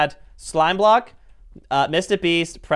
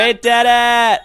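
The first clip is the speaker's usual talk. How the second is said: loud, slow, raised exclamation; triumphant cheer; 4 semitones higher, 5 dB louder, slower than usual. Real, not acted. Player celebrating the win with friends, triumphant loud cheer. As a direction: draw it out in triumph.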